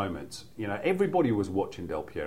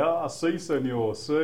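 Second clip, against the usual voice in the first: quoted catchphrase; 6 semitones higher, 3 dB louder, slower than usual. Retold or imitated. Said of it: "people say to me a lot".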